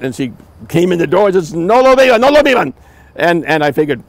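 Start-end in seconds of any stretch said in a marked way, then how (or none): 1.5-2.7 high, loud call of warning in Spanish; retold yell. Hostess shouts from the door to stop the guests, loud. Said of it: "yelled out".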